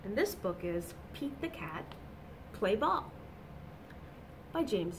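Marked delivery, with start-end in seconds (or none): none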